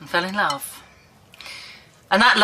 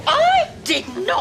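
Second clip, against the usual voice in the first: high-pitched outraged protest; indignant denial. Acted. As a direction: deny it hotly, voice shooting up. Denies blame after fight.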